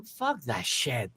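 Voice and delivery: slow, low and soft; quiet retold refusal; low dismissal. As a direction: brush it off under your breath. Muttered dismissal in retold scene.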